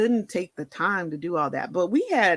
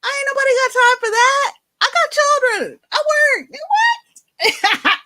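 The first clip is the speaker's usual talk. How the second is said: crying protest; 9 dB louder, much faster than usual. Retold or imitated. Refusing transfusion, crying out.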